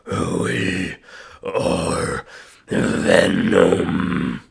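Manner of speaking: low, slow, growled Venom voice. Shown as a demonstration. Vocalist demonstrating the Venom voice to chat, low growl. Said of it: "like, the Venom voice".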